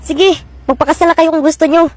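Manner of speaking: ordinary talk, nothing marked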